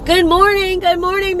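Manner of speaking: usual speaking voice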